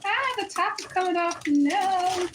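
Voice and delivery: high, loud wail of dismay; dismayed cry. Real, not acted. Daughter sees the top coming off, dismayed cry.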